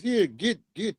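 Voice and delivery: lightly imitated clipped command; a hint of a foreign accent barking orders; mock African accent. Imitating the men who order Africans around, in a mild mock accent. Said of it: "that's how they do the Africans".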